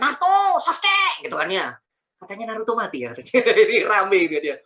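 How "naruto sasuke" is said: high, loud anime-style shout of names; mock battle cry. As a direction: yell the names like an anime fighter. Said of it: "Ganti teriak-teriak". (teriakan ala anime yang dibuat-buat)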